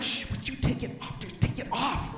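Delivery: whispered, embarrassed telling-off; imitated wife's stage whisper; urgent hushed scolding. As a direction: hush them in an urgent whisper.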